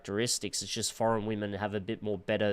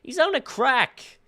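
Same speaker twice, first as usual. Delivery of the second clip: higher, loud repeated yell; shouting; 14 semitones higher, 6 dB louder than usual. Real, not acted. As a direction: shout it again in disbelief. Yelling at the video.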